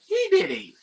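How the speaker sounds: squeaky-high imitation of a tattling kid; Kevin. Kevin blames Harry to the woman, high mock kid voice. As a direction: pitch it way up, pinning blame like a child. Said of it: "Kevin was like".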